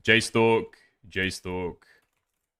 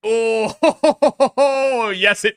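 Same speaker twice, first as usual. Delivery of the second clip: laughing; 9 semitones higher, 4 dB louder, much slower than usual. Real, not acted. Mocking echo of "yes it does.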